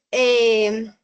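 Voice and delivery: usual speaking voice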